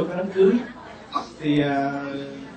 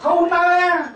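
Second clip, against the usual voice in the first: hammed-up toast from the bride's father; Quảng Nam accented English. Father at daughter's wedding toasts guests, hammed-up Quảng Nam accent. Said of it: "nói tiếng Anh mà ông nói bằng giọng Quảng Nam".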